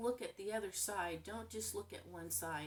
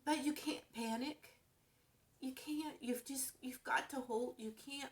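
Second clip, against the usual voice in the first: higher, half-whispered, voice close to breaking; fighting tears; 6 semitones higher than usual. Real, not acted. Talking about her niece in hospice, near tears. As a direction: hold back the tears as you plead. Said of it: "hard not to cry talking about this".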